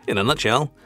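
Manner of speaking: normal talking voice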